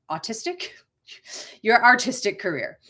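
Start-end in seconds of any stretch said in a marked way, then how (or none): 0.1-0.7 Boston accent. Real, not acted. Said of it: "that was my Boston accent coming out there".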